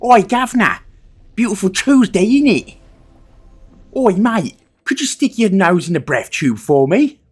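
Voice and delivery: high, loud mock cockney; over-the-top cockney parody voice; exaggerated British accent. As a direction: ham up a cockney geezer for Americans. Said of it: "every British person sounds like this".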